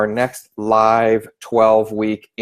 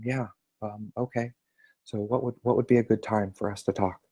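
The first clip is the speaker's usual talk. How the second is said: much softer, barely above a murmur; very quiet; 10 dB quieter than usual. Retold or imitated. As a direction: keep it hushed and timid. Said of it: "I was really being quiet".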